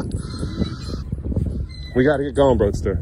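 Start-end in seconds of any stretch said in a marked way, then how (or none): 0.2-1.9 breaking into a laugh; laughter. Real, not acted. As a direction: laugh at the absurdity. Laughs mid remark.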